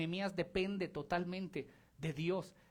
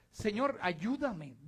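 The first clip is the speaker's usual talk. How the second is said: slow pleading prayer; much slower than usual. Acted. Nehemiah's arrow prayer voiced.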